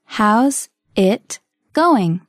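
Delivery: very slow, word by word; slow model. Shown as a demonstration. Teacher modelling a phrase for learners, very slowly. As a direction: separate every word with a gap.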